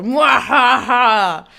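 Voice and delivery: loud, slow villain laugh; crazy evil cackle. Pretend. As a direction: cackle like a maniac in control. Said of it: "Crazy person".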